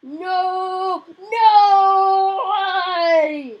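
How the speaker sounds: loud high cries of dismay; loud anguished no; despairing shout. Real, not acted. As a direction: yell it out as the game is lost. Loses the match, despairing.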